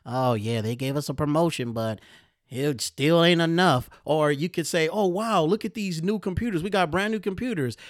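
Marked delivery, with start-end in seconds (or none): 0.1-3.9 whiny coworker, voiced lightly; grudging, never satisfied; mock complainer. Mocking coworker who says promotion isn't enough. 4.9-7.8 higher, thrilled coworker; mock delight. Coworker thrilled about new computers, mock excitement.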